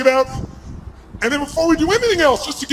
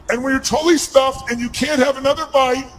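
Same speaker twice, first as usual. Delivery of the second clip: normal talking voice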